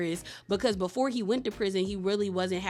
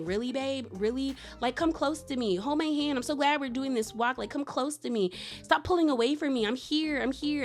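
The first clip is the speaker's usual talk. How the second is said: put-on higher-pitched, coaxing girlfriend voice; retold pleading; 5 semitones higher than usual. Girlfriend coaxing her anxious boyfriend on a walk, retold pleading. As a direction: plead lightly for closeness, voice lifted.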